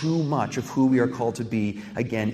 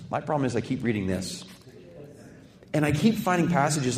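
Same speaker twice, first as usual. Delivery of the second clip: ordinary talk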